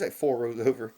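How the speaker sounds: ordinary talk, nothing marked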